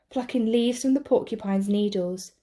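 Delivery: plain reading voice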